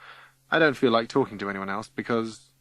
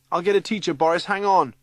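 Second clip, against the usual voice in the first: high, raised voice over a crowd; urgent calling out; 8 semitones higher, 5 dB louder than usual. Acted. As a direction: call out help is coming.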